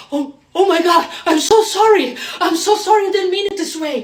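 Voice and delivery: high, loud, mock frightened pleading; panicked apology. Spooked attacker apologising to her, mock panicked apology. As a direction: imitate someone backing off in a panic.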